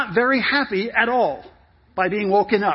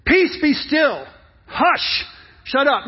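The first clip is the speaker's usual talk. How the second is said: raised, punchy orders with pauses; emphatic command; 3 semitones higher, slower than usual. Acted. Jesus wakes up and commands the storm, emphatic and powerful. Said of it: "he was emphatic and he was powerful".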